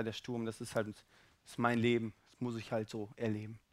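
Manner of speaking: low, soft, given-up voice; subdued resignation; resigned. Acted. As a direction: shrug it off quietly as fate.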